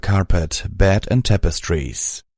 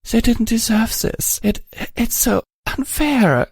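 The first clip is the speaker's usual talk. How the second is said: high, sobbing voice. A narrator's reading. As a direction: cry it out brokenly. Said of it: "as she began to sob again".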